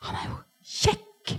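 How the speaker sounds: slow, hushed and starry-eyed; smitten aside. Retold or imitated. Girl meeting her future husband, slow smitten whisper. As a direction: let it out half-whispered, dazzled.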